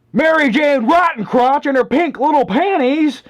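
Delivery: high shouting drill instructor line; drill sergeant. Retold or imitated. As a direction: bark it like Hartman.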